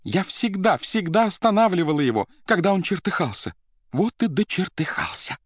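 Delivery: high-pitched, frantic lament; hysterical crying out. A narrator's reading. Secretary laments her boss's swearing to accountant, crying out. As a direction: cry it out in a high, overwrought voice. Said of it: "и вскрикивала".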